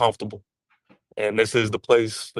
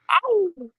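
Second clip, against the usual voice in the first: excited whoop. Real, not acted. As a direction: yelp it out like a fan celebrating. Cheering sign-off after let's go Giants.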